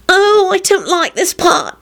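high, slow whimpering complaint; fearful dread. Acted. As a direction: shrink from the scary scene. Scared viewer hides from ghost.

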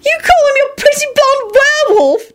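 extremely high-pitched screech; revolted screech. A narrator's reading. Ginny appalled by Kingsley's pet name, screeching. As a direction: screech it in outraged disgust. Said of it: "Jenny screeched with a revolted expression".

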